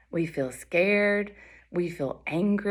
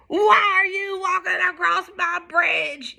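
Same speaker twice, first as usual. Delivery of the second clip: troll's yell; 10 semitones higher, 3 dB louder than usual. Acted. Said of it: "yelled the hideous".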